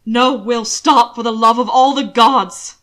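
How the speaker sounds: loud terrified shout to stop him; panicked loud command; horrified shout. Acted. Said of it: "Hannibal shouted".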